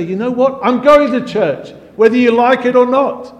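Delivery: loud, high-pitched defiant retort; defiant teenager. Acted. Teen answering back a parent about church, defiant and loud.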